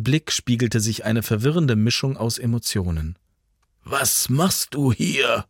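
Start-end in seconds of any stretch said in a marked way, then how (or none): 3.8-5.4 put-on slow, concerned old man's challenge; a light old man's voice, worried not harsh; old man snapping. A narrator's reading. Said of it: "fuhr er Jan an". (der Alte fährt Jan besorgt an, langsam)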